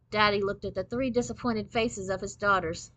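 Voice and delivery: ordinary delivery, nothing marked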